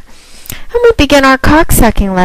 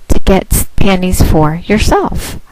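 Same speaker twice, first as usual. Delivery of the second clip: ordinary talk, nothing marked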